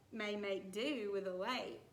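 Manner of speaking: normal talking voice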